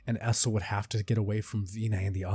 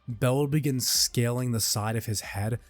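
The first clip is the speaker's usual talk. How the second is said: plain reading voice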